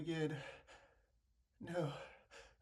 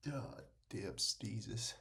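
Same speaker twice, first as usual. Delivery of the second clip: whisper; 12 semitones lower, much slower than usual. Real, not acted. Cursing between sneezes.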